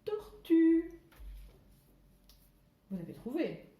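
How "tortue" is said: "tortue" rung out loud and high. Shown as a demonstration. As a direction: hit the word "tortue". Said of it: "comme dans". (« tortue » fort et aigu)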